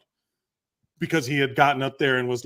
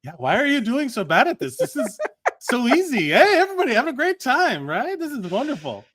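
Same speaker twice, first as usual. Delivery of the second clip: smug mockery; 9 semitones higher than usual. Mocking the heckler-turned-comic, high smug sing-song. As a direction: gloat like a cocky amateur. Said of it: "mocking the smug comic".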